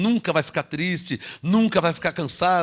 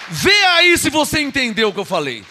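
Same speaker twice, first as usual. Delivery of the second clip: high, loud call to the church; fervent pulpit challenge; loud preacher challenge; 7 semitones higher, 6 dB louder than usual. Real, not acted. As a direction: challenge the congregation. Preacher calls congregation.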